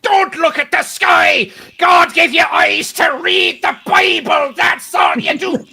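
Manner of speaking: shouted very high and loud, slow; crazed priest shouting. Retold or imitated. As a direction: rant like a mad Irish priest.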